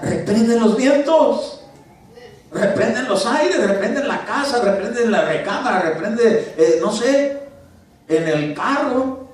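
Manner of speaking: high, loud, slow commanding rebuke; forceful rebuke. Shown as a demonstration. Preacher demonstrating a rebuke to the congregation, commanding and loud. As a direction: rebuke the winds with full authority.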